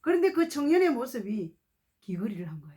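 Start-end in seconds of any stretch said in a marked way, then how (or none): 2.0-2.8 hushed, secretive reveal; confiding whisper to the congregation. Real, not acted. Revealing the earring detail.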